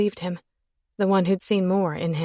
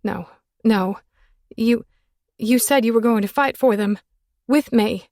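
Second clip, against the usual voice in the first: higher, louder pleading; begging; 3 semitones higher, 5 dB louder than usual. Acted. Woman begging him to fight for the kids. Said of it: "I was begging, pleading, praying".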